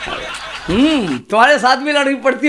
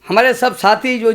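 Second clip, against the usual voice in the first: ordinary delivery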